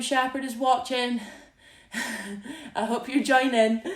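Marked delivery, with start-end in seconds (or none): 1.9-2.7 soft laugh. Real, not acted. Laughs about Graham watching.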